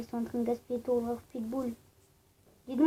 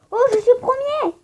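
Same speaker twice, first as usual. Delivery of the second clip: excited shout; 11 semitones higher, 11 dB louder than usual. Real, not acted. Reaching first place in game.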